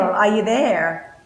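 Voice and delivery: ordinary delivery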